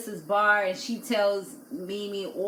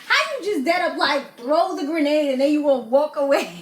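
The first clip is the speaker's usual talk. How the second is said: loud, higher exasperated challenge; exasperated outburst; 5 semitones higher, 6 dB louder than usual. Retold or imitated. Vlogger calling out the Jasmine chick, loud exasperated outburst. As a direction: call her out for walking away. Vlogger's own reaction to the grenade.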